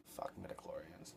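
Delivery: hushed whispered curse. Real, not acted. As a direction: breathe it out under your breath. Muttered curse at movie concept.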